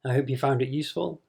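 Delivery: ordinary delivery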